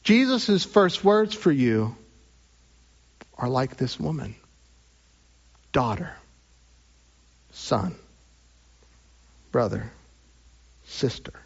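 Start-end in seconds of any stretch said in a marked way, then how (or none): 5.7-11.3 tender naming. Acted.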